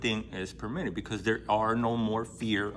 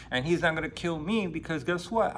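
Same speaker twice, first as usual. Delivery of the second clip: ordinary talk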